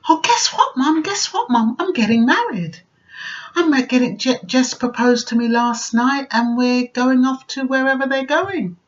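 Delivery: bright excited daughter. Retold or imitated. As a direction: burst in like a giddy girl with big news. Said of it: "as bright as a button".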